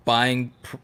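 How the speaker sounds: normal talking voice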